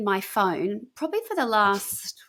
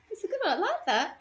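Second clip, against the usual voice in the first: laughing; 11 semitones higher, 7 dB quieter than usual. Real, not acted. Laughing at Coke Zero joke.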